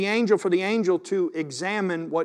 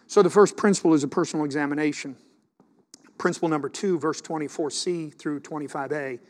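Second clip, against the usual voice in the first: ordinary talk, nothing marked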